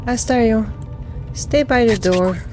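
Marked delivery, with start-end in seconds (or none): none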